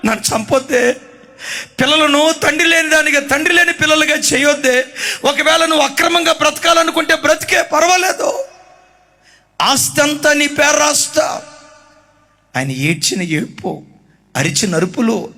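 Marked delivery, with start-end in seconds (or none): 0.1-11.4 pleading husband. Retold or imitated. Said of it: "భార్యను బ్రతిమలాడుకుంటున్నాడు".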